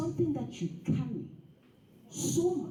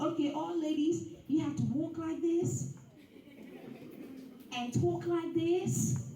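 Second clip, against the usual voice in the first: mock lifestyle coach; 5 semitones higher than usual.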